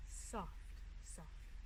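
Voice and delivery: whispered and fading away. Acted. Narrator ending the winter scene, fading whisper. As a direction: let the words dissolve into air. Whistle fades softly.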